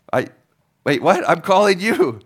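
high incredulous disbelief; can't believe what he's hearing; caller's high, loud bewildered protest; baffled caller. Acted.

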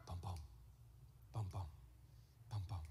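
soft steady bum bum like a heartbeat; heartbeat imitation. Shown as a demonstration. Preacher mimicking a heartbeat for the congregation, steady and rhythmic. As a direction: tap out a pulse with the voice. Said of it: "If you think of your heart".

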